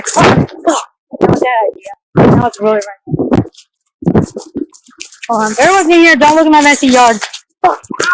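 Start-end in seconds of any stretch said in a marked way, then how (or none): none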